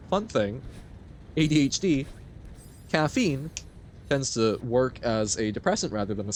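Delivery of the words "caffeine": ordinary talk, nothing marked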